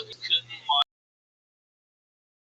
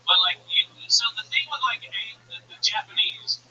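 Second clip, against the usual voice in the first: normal talking voice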